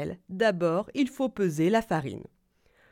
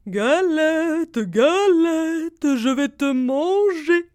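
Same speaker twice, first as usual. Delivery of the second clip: loud, slow menacing wolf; big bad wolf; 8 semitones higher, 10 dB louder, much slower than usual. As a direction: loom over the galette as the wolf. Wolf threatens the galette.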